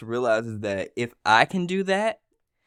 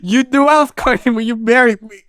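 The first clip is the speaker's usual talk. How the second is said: higher, louder mock scold; wife scolding her husband, higher and louder; mock wife accusation; 11 semitones higher, 9 dB louder than usual. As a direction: accuse him of running away.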